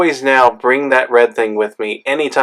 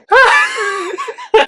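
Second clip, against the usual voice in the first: high shouted laugh; a panicked shout of a laugh; shrieking laugh. Retold or imitated. After the needle stick.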